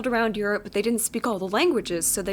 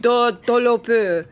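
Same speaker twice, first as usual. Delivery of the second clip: fake French gibberish; 6 dB louder, much slower than usual. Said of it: "French. Let's hear it".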